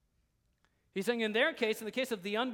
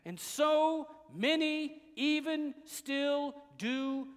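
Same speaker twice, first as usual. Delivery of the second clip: raised, ringing proclamation; full-force preaching; 5 semitones higher, 6 dB louder than usual. Real, not acted. Preacher proclaiming Christ's death and return, full-force preaching. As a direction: declare each point with emphatic conviction.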